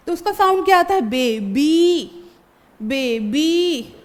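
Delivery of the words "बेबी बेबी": बेबी very slow, ee vowel stretched; drawn-out vowel. Shown as a demonstration. To the class, a teacher stretches बेबी slowly. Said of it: "उसको थोड़ा खींचते हैं".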